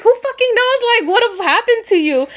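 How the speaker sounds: high, alarmed rush of words; acted freak-out, speeding up toward the end. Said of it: "Val freaks out".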